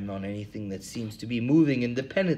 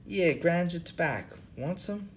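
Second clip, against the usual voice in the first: lightly voiced drifter, pitched up; a weary bum who cares for nothing, high; second hobo voice; 7 semitones higher than usual. Bum Hubbard answering the interviewer, in a high tired voice.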